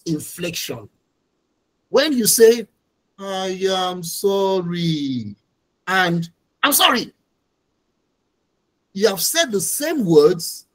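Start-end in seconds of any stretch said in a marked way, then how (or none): 3.2-5.3 mean it, give it time; slow heartfelt "I am sorry"; sincere apology. Shown as a demonstration. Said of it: "the manner you have said them is totally different". 6.6-7.1 "I'm sorry" tossed off quick and higher, falling on "sorry"; quick, higher, dismissive "I'm sorry"; offhand apology. Shown as a demonstration. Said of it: "the manner you have said them is totally different".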